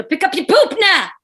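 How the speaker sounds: high loud goofy yell out a car window; silly yelling. Retold or imitated. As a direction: shout it out the window for laughs. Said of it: "yelling out the window".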